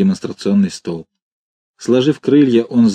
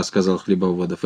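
ordinary delivery, nothing marked

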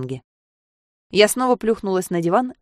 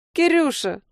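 put-on much higher, bleating drunkenly; 10 semitones higher, slower than usual. A narrator's reading. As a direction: bleat the name like a sheep. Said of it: "проблеяла она".

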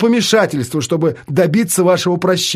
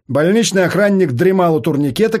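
ordinary delivery, nothing marked

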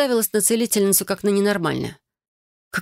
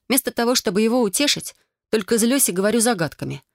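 usual speaking voice

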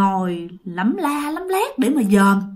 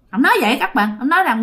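plain reading voice

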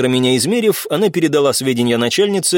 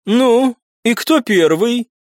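high, slow, drawn-out question; woman clerk voice; 10 semitones higher, much slower than usual. Acted. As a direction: stretch it out, much higher.

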